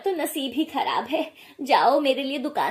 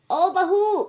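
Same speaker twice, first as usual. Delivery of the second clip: higher, loud call across the house; 7 semitones higher, 7 dB louder, much slower than usual. Acted. Mother-in-law calling her daughter-in-law from afar, loud call. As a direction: summon her from another room.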